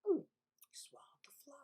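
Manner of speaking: fast whispered aside; hushed narration. A narrator's reading. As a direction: lean in and whisper it. Narrator hushed storytelling moment.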